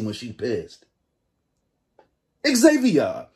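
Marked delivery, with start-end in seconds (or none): 2.5-3.3 put-on higher, louder stab at her African accent; bad imitated accent. Reactor tries out the woman's accent on a name, badly imitated accent. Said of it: "That accent was terrible".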